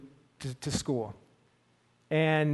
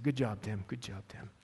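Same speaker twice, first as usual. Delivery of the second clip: gentle praise; 8 semitones lower, 13 dB quieter than usual. Retold or imitated. Narrator reassuring Tim quietly.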